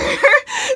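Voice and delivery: high, loud burst of laughter. Real, not acted. Laughing at her mom almost landing in jail, loud burst.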